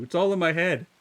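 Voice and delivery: loud teasing protest, falling; loud retort. Real, not acted. Streamer repeating the protest to chat, slightly calmer.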